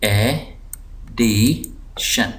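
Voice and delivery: Addition very slow, three separate syllables. Shown as a demonstration. Teacher dividing the word for students, slow split syllables. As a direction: break addition into ए, डि, शन with pauses. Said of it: "इस syllable को मैं दबाव दे रहा हूँ".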